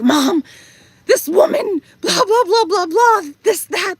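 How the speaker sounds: high-pitched, whiny mock venting to mom. Daughter calling her mom to vent about a woman, venting. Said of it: "venting to her".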